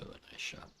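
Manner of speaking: hushed golf whisper. Acted. Whispered golf commentary aside.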